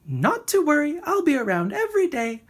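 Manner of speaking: high, chirpy little bird. A narrator's reading. The little bird cheerfully reassuring Rocket, high and chirpy. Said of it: "chirped the bird".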